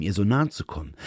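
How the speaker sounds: plain reading voice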